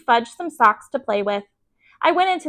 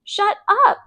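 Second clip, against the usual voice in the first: angry snap; 10 semitones higher than usual. A narrator's reading. Peter snapping at Sheila.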